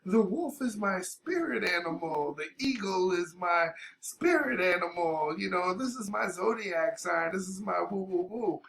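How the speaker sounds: mocking spiritual types. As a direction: parody someone earnest about spirit animals. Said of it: "some people are like".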